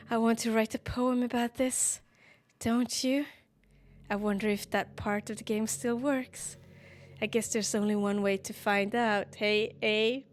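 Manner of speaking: usual speaking voice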